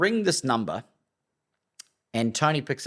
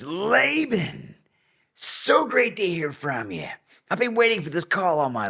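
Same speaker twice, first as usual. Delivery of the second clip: higher, slow, gushing imitation of Steve; mock-gushing guru greeting; effusive welcome; 8 semitones higher, 3 dB louder than usual. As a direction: gush like a guru who was expecting you.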